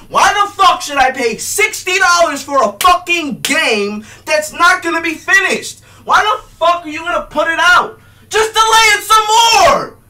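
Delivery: ranting shout at game developers; furious yelling. Real, not acted. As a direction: yell it out in outrage. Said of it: "me fucking yell".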